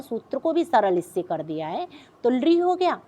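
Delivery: ordinary talk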